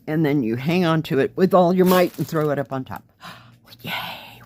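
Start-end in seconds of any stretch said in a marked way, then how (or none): 3.2-4.4 breathy whispered yay; little gasp of triumph. Real, not acted. Gets the canvas hung up.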